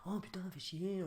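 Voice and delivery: soft, sulky mock grumble; muttered swear. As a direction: mumble the curse under your breath. Son's grumble retold.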